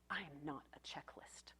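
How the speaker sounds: the teen's protest, retold in a whisper. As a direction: breathe it out as a whisper. Quoting the patient's protest.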